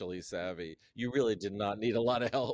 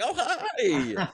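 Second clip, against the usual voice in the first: much higher, louder, a gushing squeal with a laugh; fake gushing hi; 14 semitones higher, 5 dB louder than usual. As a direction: squeal it like you're thrilled to see her. Gushing on recognizing a celebrity.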